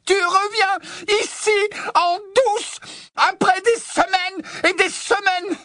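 slow, shrieked accusation, very high; fureur. Acted. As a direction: hammer out each phrase in rage. Hermione furieuse frappe Ron. (reproche hurlé, lent et très aigu)

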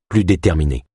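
ordinary delivery

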